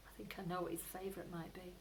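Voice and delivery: plain reading voice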